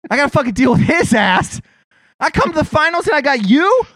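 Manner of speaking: exasperated mock Breen complaining, pitch rising; voicing Mike Breen. Fed-up announcer complains about his broadcast partner, mock exasperated. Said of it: "Poor Mike fucking Breen's like".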